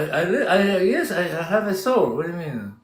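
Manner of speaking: usual speaking voice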